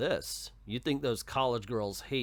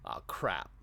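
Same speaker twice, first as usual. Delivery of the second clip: ordinary delivery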